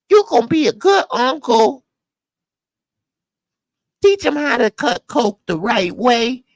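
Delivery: high mock female voice scolding and advising; falsetto mock woman. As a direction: ham up a bossy woman instructing Tommy. Mock female character lecturing Tommy.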